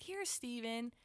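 a hint of beaming granny; proud grandmother. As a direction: show off your son, pitch lifted. Grandmother showing photo of son.

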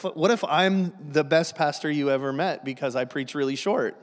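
normal talking voice

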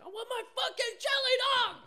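high, loud enraged customer yell; full-blast tantrum shriek, acted out; imitated screaming. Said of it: "he's like screaming out".

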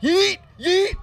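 very high, loud whoop; short sharp squeal of excitement; excited yelp. Real, not acted. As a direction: shriek it in a high-pitched cheer. Excited cheering at the video.

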